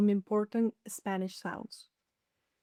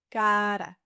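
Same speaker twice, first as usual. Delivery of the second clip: slow, level "Cara", first vowel held; drawn-out demonstration; 2 semitones lower than usual. Shown as a demonstration. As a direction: stretch "Cara" slowly for learners. Word repeated slowly.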